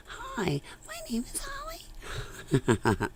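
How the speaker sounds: very high tiny squeak, slowed; squeaky mouse voice. Pretend. Mic sounds too high, mock mouse voice. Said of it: "now I sound like a mouse".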